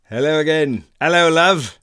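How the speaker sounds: loud, high put-on English accent. Shown as a demonstration. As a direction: belt out a hammed-up English greeting. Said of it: "do your English accent for me".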